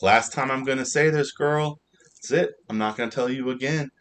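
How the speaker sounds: a judge's final warning, hammed up; mock stern judge. Judge scolding the counselor, retold.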